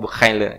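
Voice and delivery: "Bukhayle" as an Indonesian's tentative French, boo-KAI-luh; unsure French pronunciation. Real, not acted. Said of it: "Prancis itu kan susah bacanya". (pelafalan Prancis "Bukhayle" yang ragu-ragu)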